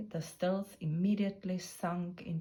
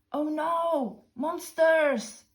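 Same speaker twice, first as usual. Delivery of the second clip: much higher, louder frightened cry; terrified cry; 9 semitones higher, 7 dB louder, much slower than usual. Acted. Cowardly hunters see monster footprints, high loud frightened cry. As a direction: cry out in panic at the tracks. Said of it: "shaking with fear".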